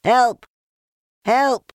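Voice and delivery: very high, loud squeals for help; panicked cry. A narrator's reading. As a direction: shriek it as a tiny frightened piglet. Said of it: "cried Piglet".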